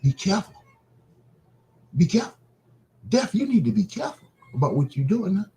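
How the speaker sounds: high-pitched admonishing voice; Christ warning death. Acted. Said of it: "this is the Christ talking".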